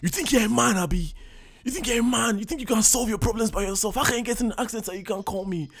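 high, loud, furious imitated rant; dad spazzing. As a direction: play the outraged father yelling at his son. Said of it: "my dad just starts spazzing".

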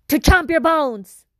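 monster roar. Acted. Creature's final answer, jump scare.

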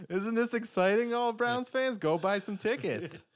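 high, laughing salesman voice; mock ad pitch. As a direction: pitch it like a cheesy ad. Mock team-owner sales pitch.

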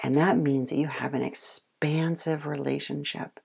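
ordinary talk

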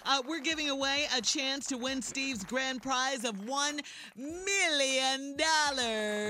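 slow, stretched-out prize reveal; booming prize announcer. Acted. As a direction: draw out the big money like a host. Radio promo, prize hype.

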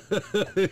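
short high-pitched laugh; quick loud laugh. Real, not acted. Laughs at friend.

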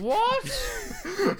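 what, shrieked very high; high shocked disbelief; shocked squeak. Real, not acted. Reacting to Voldemort named Elvis, shrieking high.

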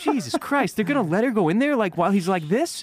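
high, fast shocked disbelief; shocked outrage. Retold or imitated. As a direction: blurt it out appalled. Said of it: "And I'm like".

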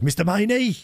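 high mock hail of a name; imitated shouting. Delivery man greeting a customer in town, imitated shout. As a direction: sing out the greeting high, mimicking him. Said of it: "he shouts down the".

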